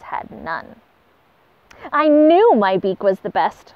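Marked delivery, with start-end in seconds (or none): 1.9-3.7 louder, boastful squawk; a proud bird squawking its triumph. Acted. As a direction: crow about winning, loud. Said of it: "squawked the spoonbill".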